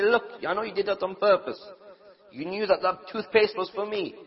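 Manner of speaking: hammed-up angry husband confronting his wife; mock accusation. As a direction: accuse her like a petty husband.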